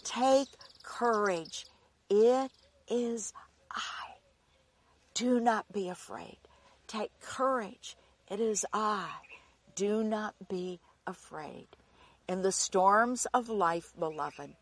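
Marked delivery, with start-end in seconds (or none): 0.0-6.4 gentle reassurance. Acted. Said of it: "Jesus spoke to them, saying". 6.9-11.8 softer hushed reassurance. Acted. Jesus soothing his frightened disciples, softly and tenderly. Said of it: "Jesus spoke to them, saying".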